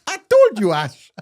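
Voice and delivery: loud, hammed-up African accent. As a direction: scold the customer in a booming mock African accent. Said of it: "why are you going all African on me?".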